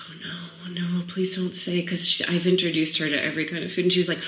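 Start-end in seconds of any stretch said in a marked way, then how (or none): 0.1-1.8 soft whispered dread, pleading under the breath; hushed mock panic. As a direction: beg quietly, hoping she won't say it. Dreading daughter's answer.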